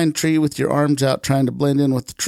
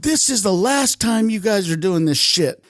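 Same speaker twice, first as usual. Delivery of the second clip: high, indignant retold scolding; swearing at them in retold anger; pissed off; 7 semitones higher than usual. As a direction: lay down the law, pitched up. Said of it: "I'm swearing at him, you know, I'm pissed".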